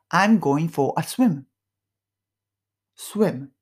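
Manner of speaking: slow, careful English example; slow model phrase. Shown as a demonstration. Teacher reading the example slowly to learners. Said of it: "model English phrase read slowly".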